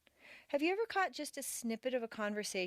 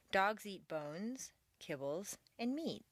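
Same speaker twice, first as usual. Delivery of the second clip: slow list, pause after "bones" and "kibbles"; list phrasing; slower than usual. Shown as a demonstration. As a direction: lift "bones" as unfinished, end the list on "meat".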